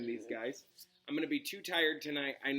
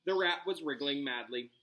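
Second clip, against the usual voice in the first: ordinary delivery, nothing marked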